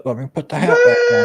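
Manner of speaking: shrieking high and loud; high-pitched shout. Pretend. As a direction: yelp it out at the top of the voice. Mock outburst at Atari.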